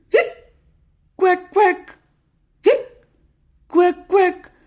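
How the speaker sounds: high hic, then duck quacks; squeaky cartoon duck noise; playful high quack-quack; quacking hiccup.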